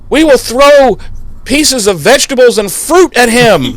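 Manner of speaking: high, hammed-up villager cry; villager proclaiming. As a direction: declaim it high like an angry mob. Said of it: "The guy's like".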